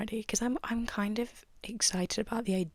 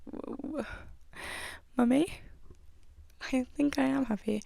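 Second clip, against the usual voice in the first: surprised; 5 semitones higher than usual. Retold or imitated. Retelling her surprised reply.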